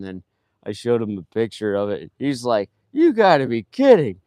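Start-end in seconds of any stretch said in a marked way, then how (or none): 2.9-4.2 loud, high-pitched mock astonishment; retold disbelief. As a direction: imitate a coworker who can't believe it.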